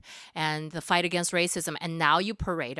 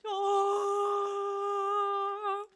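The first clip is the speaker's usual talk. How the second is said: a long high excited gasp; 14 semitones higher, 3 dB quieter than usual. Real, not acted. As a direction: gasp in real delight at the wine. Gasping at refilled wine.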